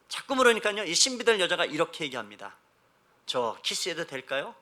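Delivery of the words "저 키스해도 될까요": earnest cautious groom. Retold or imitated. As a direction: ask gravely and hesitantly, drawing it out. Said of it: "늘 진지하게 묻습니다". (낮고 느리게, 진지하고 조심스럽게 묻는 신랑)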